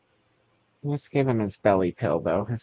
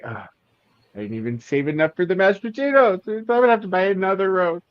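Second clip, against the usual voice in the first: higher, hammed-up moan of regret; mock exasperation; 9 semitones higher than usual. Sleep-eater regrets the missing roast next morning, mock exasperated. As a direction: complain in playful self-reproach.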